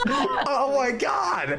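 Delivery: high, laughing words; laughing shout. Real, not acted. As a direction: shout through the giggles. Cracking up at the joke.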